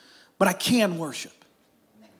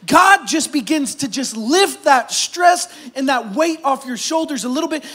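raised, driving sermon voice; impassioned preaching; 4 semitones higher, 5 dB louder than usual. Real, not acted. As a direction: preach it with full force and urgency. Preaches at full force.

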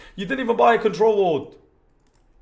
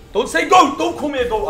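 angry shout; 9 semitones higher, 8 dB louder than usual. Real, not acted. Yelling at chat not to tease.

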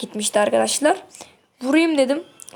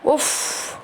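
frustrated sigh; 2 semitones higher, 4 dB quieter than usual. Real, not acted. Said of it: "sinir oldum ya".

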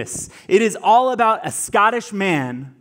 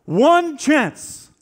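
one chance thrown out much higher and louder; rising rallying cry; 8 semitones higher, 4 dB louder than usual. Retold or imitated. Wallace peaking his speech to the troops, loud and high. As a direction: hit one chance hard as the peak of the speech. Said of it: "And he says".

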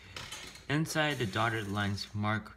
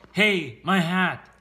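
loud, high, stretched-out yell; outraged cry; 8 semitones higher, 10 dB louder, slower than usual. Acted. Mayor sees the dog with the hat, outraged yell. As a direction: yell in protest at a theft.

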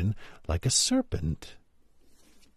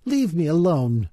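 higher-pitched indignant protest; 6 semitones higher than usual. A narrator's reading. Alice fends off the attacking pigeon, indignantly. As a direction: tell them off in a raised pitch. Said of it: "said Alice indignantly".

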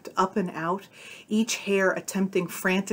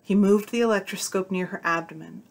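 ordinary delivery

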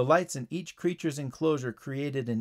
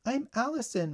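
put-on high, small child's voice; little girl; 10 semitones higher than usual. A narrator's reading. Bitten girl tells the paramedic her name, high small voice. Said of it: "replied the girl".

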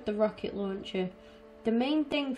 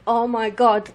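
exasperated cry; 9 dB louder than usual. Real, not acted. Losing it at the game.

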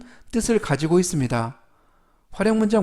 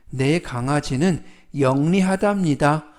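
ordinary delivery

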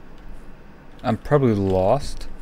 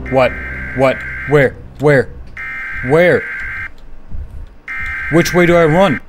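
loud, high, frantic questions; panicked; 5 semitones higher, 5 dB louder than usual. Real, not acted. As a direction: fire out questions in rising panic. Monster chase begins in game.

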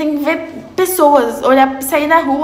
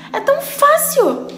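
high mock-easy; 12 semitones higher, slower than usual. Real, not acted. Mocking how easy.

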